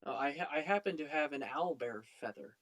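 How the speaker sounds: normal talking voice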